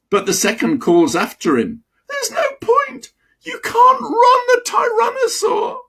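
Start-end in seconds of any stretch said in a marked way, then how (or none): 2.1-5.8 high, squeaky cry of a tiny dinosaur; tiny frantic dinosaur voice; panicked little dinosaur. Acted. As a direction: shriek it after him in panic. Little dinosaur calling after friend.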